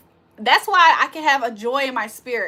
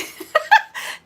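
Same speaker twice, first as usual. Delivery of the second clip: airy high-pitched giggle; breathy high laugh; 4 dB louder than usual. Real, not acted. Laughing at chat comment.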